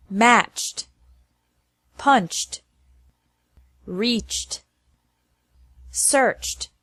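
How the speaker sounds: one at a time, "reached", "searched" with ED as a final T, no extra syllable. Shown as a demonstration. Teacher drilling verbs ending with a CH sound, ED said as T in "Matched", "searched". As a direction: say "Matched", "punched" ending in CH plus T. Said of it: "after verbs ending with a C H".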